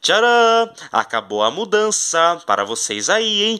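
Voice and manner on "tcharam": high, loud showy ta-da; bright triumphant reveal. Pretend. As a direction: present it like a magic trick. Unveiling the new house.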